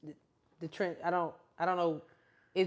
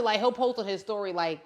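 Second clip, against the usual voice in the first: normal talking voice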